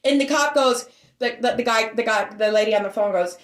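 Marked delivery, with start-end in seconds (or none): none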